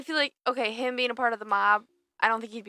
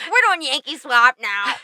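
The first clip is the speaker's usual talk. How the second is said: loud, high, drawn-out mimicry; mock petulant announcement; 4 semitones higher, 8 dB louder than usual. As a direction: imitate a sulky boss changing the rules.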